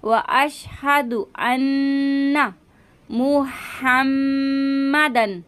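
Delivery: अन्ना and मुहम्मदन very slow, doubled न and म held nasally; nasal ghunna. Shown as a demonstration. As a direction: hum through the double न and म. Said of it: "नून मुशद्दद का हम गुन्ना करेंगे". (गुन्ना के साथ धीरे)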